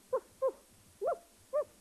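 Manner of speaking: high yappy woof woof; puppy barking. A narrator's reading. Puppy spotting a dog, high yappy barks. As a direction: bark like a small puppy.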